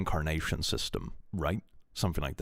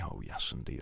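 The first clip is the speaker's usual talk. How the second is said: whisper; 8 semitones lower, 10 dB quieter than usual. Real, not acted. Whispered catchphrase.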